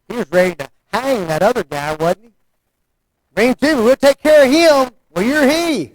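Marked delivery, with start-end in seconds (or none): 3.3-4.9 high, loud indignant demand, higher and louder; an angry king ordering punishment. Acted. David voiced, angry demand. 5.1-5.9 prophet's retort. Acted. Nathan's reply voiced.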